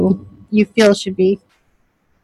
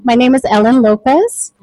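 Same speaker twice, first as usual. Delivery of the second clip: normal talking voice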